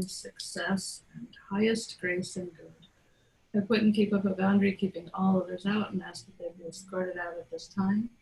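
soft, hushed invocation well under her talking voice; quiet prayer. Acted. Host invoking protection before the story, quiet prayer. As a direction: pray softly over the circle, setting boundaries.

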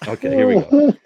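muffled from a paper bag over the head. Real, not acted. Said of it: "do the show with this bag on my head".

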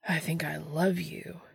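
hushed, slow declaration of love; soft whispered swoon; passionate. A narrator's reading. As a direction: breathe it out slowly, full of adoration. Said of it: "Dean says passionately".

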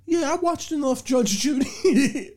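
high-pitched, drawn-out dad impression; slow, high mock-dad voice; imitated dad. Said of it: "my dad goes".

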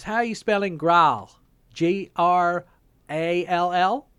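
high, loud Southeast US drawl, spelling slowly; imitated Southeast accent. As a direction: mimic a Southeast operator misreading a word. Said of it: "very Southeast accent".

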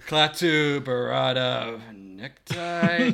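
slow, drawn-out fumbled incantation; mumbled magic words. Retold or imitated. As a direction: stretch out the botched spell. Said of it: "gets over there".

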